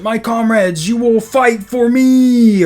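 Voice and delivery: slow, loud mock Russian accent; imitating a Russian dictator accent. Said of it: "Just imagine I'm doing a Russian accent".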